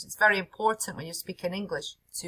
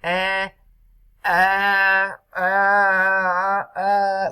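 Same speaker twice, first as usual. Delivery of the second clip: long, loud, droning eh; hesitant filler demo; 2 semitones higher, 9 dB louder, much slower than usual. Shown as a demonstration. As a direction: imitate a stuck speaker stretching each filler. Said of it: "the problem is when we are".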